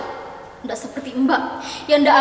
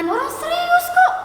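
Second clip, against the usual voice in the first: indignant protest. Real, not acted. Protesting sister's joking.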